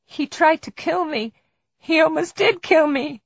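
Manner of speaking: high-pitched, distressed outcry; distraught victim. Acted. Victim cries out about attack.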